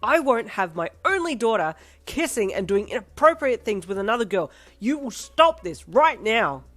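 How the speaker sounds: laying down the law; angry hollering. Acted. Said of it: "your dad hollered".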